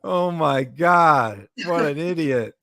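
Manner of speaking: high, slow mocking voice; mock scoff. Still mocking the rival to the co-host, high and scoffing. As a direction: scoff at her in a put-on high voice.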